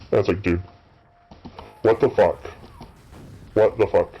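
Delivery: usual speaking voice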